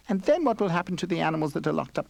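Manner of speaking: high, fast squeak of a question; squeaky mouse. A narrator's reading. Mouse asks the bear anxiously, squeaking voice. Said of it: "the mouse squeaked".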